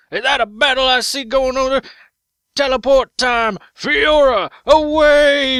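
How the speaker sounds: loud, high rallying cry; heroic call. Pretend. Fiora teleporting into the fight, loud heroic shout. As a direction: shout it like a hero charging.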